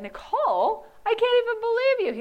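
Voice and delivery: mock his incredulous teasing; guy friend laughing in her face, high and loud; amused guy friend. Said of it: "And he said".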